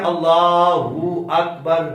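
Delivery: اللہ اکبر with the hu sound drawn out long; wrong, stretched hu. Shown as a demonstration. Teacher mimicking worshippers who stretch the hu in takbir. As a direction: drag out the hu after اللہ, slowly. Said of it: "ہو کو لمبا کر دیتے ہیں".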